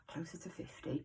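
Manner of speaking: hushed, sighing admission; sighed dread. Real, not acted. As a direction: breathe it out with a sigh, very softly. Mock dread at nearing fifty.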